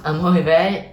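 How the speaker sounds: usual speaking voice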